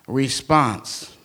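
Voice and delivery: ordinary delivery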